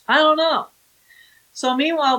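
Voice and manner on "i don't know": high, airy I-don't-know; innocent denial. Retold or imitated. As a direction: play dumb, pitched up. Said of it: "Who's Russ?".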